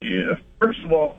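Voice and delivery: choppy phone line; radio breaking up. Real, not acted. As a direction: call dropping in and out. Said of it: "you're cutting out".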